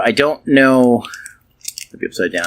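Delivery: normal talking voice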